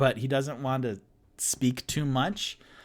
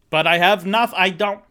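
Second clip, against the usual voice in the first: flustered outburst; 7 semitones higher, 8 dB louder than usual. Real, not acted. Panicked admission of no plan.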